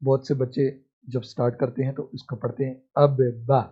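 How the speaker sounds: ordinary talk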